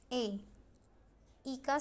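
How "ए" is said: ए as a short clipped e; short e sound. Shown as a demonstration. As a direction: say ए like egg. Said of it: "ई का जो sound होता है". (छोटी ए ध्वनि)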